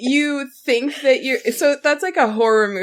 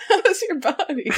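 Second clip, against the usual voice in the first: laughing; 5 semitones higher than usual. Retold or imitated. Quoting the uncle's line.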